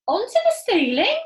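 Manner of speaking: high, loud disbelief; astonished cry. A narrator's reading. Small monkey doubting his dad, high and astonished. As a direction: squeak out the shock. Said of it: "cried one of the small monkeys".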